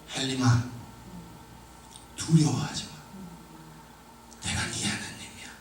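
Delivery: hushed, fading to a whisper; still small voice. Retold or imitated. Preacher voicing God calling his name, hushed whisper. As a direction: speak it soft as a voice only he could hear. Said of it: "아주 세미한 음성".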